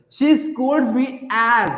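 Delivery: each word drawn out very slowly; slow teacher dictation, word by word; very slow example. Shown as a demonstration. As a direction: dictate "she scolds me as" at a crawl. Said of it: "अब देखो मैंने as के बाद".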